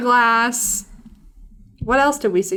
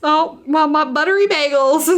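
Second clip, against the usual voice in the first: put-on Southern accent; 7 semitones higher, 4 dB louder than usual. Quoting a book character's funny phrases in an imitated Southern accent. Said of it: "She talks in a really deep Southern accent".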